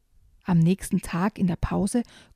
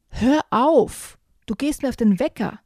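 outraged shout, higher and louder on Hör auf; indignant cry; 6 semitones higher, 3 dB louder than usual. Acted. Jojo telling pestering Maximilian to stop, indignant cry. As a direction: snap it out in mild outrage. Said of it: "ruft Jojo empört".

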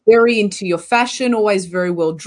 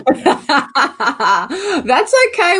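laughing; 3 semitones higher, much slower than usual. Real, not acted. Host laughing off guest's dodge.

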